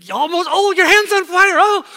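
high, loud, breathless-paced urging; excited egg-hunt cheering. Acted. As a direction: cheer on a child who is nearly there. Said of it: "what do you start to say".

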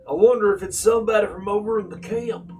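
put-on a light Grawl accent with a long drawl. Aileen wonders about the strangers, in a light Grawl drawl. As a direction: stretch the vowels in a thick Grawl accent. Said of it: "He has a little bit of a long drawl".

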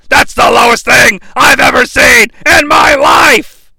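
loud, high commentator outrage; outraged wrestling commentator. Retold or imitated. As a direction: shout it like an appalled ringside announcer. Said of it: "and we hear this".

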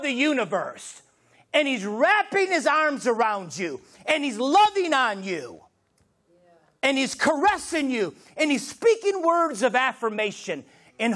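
rising, driving sermon build; impassioned preaching. Real, not acted. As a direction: preach it in mounting waves.

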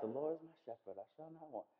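soft, hushed, devout psalm reading; preacher quoting a calm psalm, soft and hushed; reverent recitation. Retold or imitated. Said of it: "Some prayers are like".

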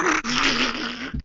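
high, strained sound of firing while dying; imitated gunfire burst. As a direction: fire the gun as you fall back. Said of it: "so I just went".

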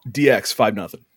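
ordinary delivery

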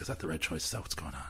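hushed, near-whispered self-doubt; very soft second-guessing to oneself; in his head. Retold or imitated. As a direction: drop to an anxious inner murmur. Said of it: "I'm always super duper in my head".

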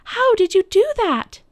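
put-on high, loud girl's voice in wonder; amazed Lucy. A narrator's reading. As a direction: ask it high and loud, astonished. Lucy amazed at Aslan's return.

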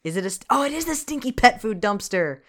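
normal talking voice

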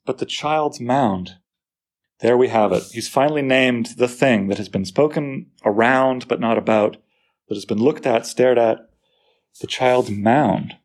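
usual speaking voice

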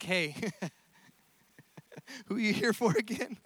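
soft, amused question through a little chuckle; chuckling under breath. Acted. Jesus to soldiers who fell down, chuckling under his breath. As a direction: ask it as if holding back a laugh. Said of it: "just a little chuckle under his breath".

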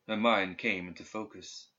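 plain reading voice

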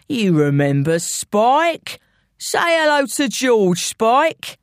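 slow, taunting gangster; drawn-out mock-friendly threat; London villain. Intruder prompting his henchman to greet George, taunting villain.

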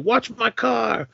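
high, drawn-out imitated warning; mock angry holler. Sonny throwing a can at the bikes, mock angry holler. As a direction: stretch it out high, like hollering at vandals.

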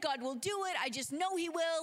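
hyped, high and sure; Martha. Acted. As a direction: burst out with excited certainty.

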